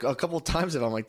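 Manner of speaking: ordinary delivery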